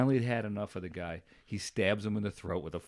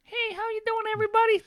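much higher, put-on wiseguy greeting; Nikki's voice; 5 dB louder than usual. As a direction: belt it out high like a loudmouth barfly. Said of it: "That's how Nikki talked".

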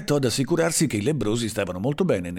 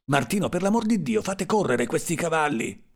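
high, urgent cry to the driver; sudden yell; 7 semitones higher than usual. A narrator's reading. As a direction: burst out in a high pitch, without much volume. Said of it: "urlò subitamente". (urlo improvviso, acuto e concitato)

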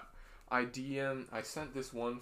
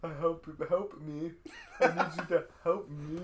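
raised mock-whiny plea through laughter; mocking echo; 4 semitones higher than usual. Teasing co-host parrots his hurt friend, mock-whiny. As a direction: parrot it back like a pouty baby. Mocking co-host's words back at him.

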